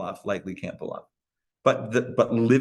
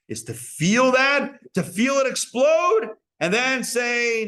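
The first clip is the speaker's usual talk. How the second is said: rising, louder, shouting on explode; building excitement; 9 semitones higher, 4 dB louder, slower than usual. Real, not acted. Teacher describing a temptation exploding inside, shouting with energy. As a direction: let it build and burst out. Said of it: "builds to explosion".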